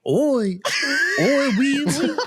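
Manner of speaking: a hammed-up oui oui; French accent. Shown as a demonstration. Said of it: "do some French".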